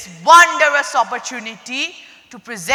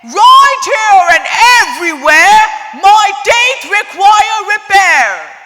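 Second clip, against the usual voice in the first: higher, louder sly crocodile voice; 7 semitones higher, 5 dB louder than usual. Acted. Crocodile in the dentist's chair demands repairs, loud and sly. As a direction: give the crocodile a loud, cunning call. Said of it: "He said".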